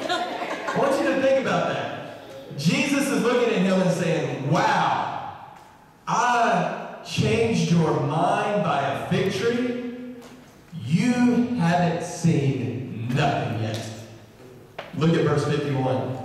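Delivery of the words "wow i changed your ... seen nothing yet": very slow, drawn-out words; wry disbelief. Retold or imitated. Jesus marvelling at Nathanael's change of mind, wry disbelief. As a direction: stretch each phrase in amused disbelief.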